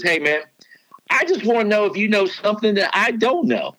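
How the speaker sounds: imitating a coach's Southern twang, pitched well up; high, twangy Southern accent in a playful retelling. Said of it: "he's got the twang going".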